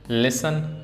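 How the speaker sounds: ordinary delivery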